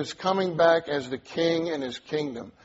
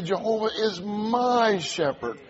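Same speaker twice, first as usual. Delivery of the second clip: "my" raised and held long; stress on my; 4 semitones higher than usual. Shown as a demonstration. Preacher emphasizing different words of the verse, stress on "my". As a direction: punch "my" high, then fall away on the rest. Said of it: "We can even emphasize different words".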